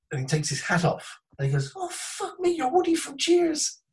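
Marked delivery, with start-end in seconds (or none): 1.8-3.8 very high, amazed imitated outburst; astonished recognition. Storyteller as friend recognising the lost American, high astonishment. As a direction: squeal it in stunned recognition.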